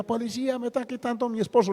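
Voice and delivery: high, mock cry for help; retold outcry. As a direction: imitate a woman wailing for police. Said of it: "formó la gritería".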